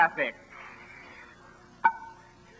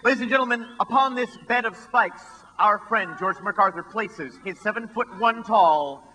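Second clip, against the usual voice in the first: showman presentation; 2 semitones higher than usual. Acted. Sideshow emcee presenting stunt.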